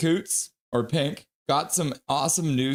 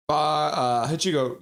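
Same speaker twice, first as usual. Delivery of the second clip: ordinary talk, nothing marked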